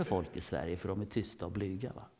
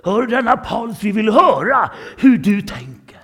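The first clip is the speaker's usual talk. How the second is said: synagogue elders calling out; 12 semitones higher, 18 dB louder than usual. Acted. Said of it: "så säger judarna i synagogan". (judarna i synagogan ropar högt och långsamt)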